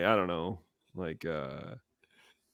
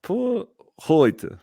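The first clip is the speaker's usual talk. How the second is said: high mock accent, trailing into a whisper; high mock German ending in a whisper; 11 semitones higher, 4 dB louder than usual. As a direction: sign off in playful put-on German. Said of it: "accents in other languages".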